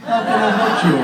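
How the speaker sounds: laughing. Real, not acted. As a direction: throw it out again with a laugh.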